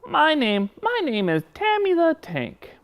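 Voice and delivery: high-pitched puppet voice with T for S; puppet friend. Pretend. Puppet introducing himself to the host, high lisping voice. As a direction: play a high puppet who lisps S into T. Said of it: "I have a friend here".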